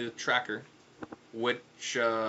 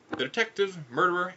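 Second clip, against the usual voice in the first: plain reading voice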